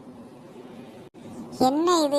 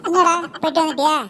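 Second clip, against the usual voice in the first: ordinary delivery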